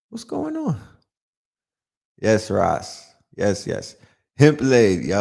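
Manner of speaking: ordinary talk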